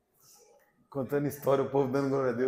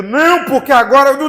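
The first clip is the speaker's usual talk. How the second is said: high, very loud outburst; shouted refusal; 9 semitones higher, 17 dB louder than usual. Retold or imitated. Preacher acting out his refusal to God, shouted protest. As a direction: explode into a high-pitched protest.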